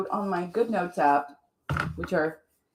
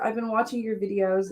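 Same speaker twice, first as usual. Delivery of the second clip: ordinary delivery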